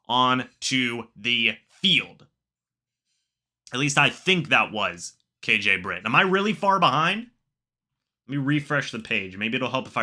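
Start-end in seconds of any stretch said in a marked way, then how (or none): none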